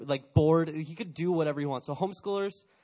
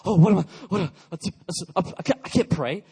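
mock panic; 2 semitones higher, 8 dB louder than usual. Student panicking when asked to pray.

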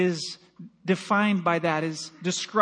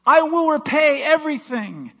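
high, loud desperate promise; begging servant; 8 semitones higher, 7 dB louder than usual. Acted. Kneeling servant promises master everything, high loud pleading. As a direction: plead like a man about to lose everything. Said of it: "he begged".

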